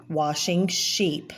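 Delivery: ordinary delivery, nothing marked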